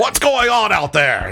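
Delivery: high-pitched acted holler from another room; calling out. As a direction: call out like a startled chef. Chef calls out from inside.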